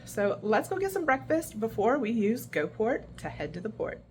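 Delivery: ordinary delivery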